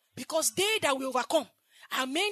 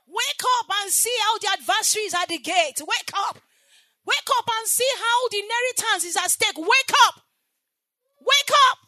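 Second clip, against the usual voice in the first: louder and higher with each wake up; urgent wake-up call; 8 semitones higher, 10 dB louder than usual. Acted. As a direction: rouse a sleeping congregation. Calling the church to wake.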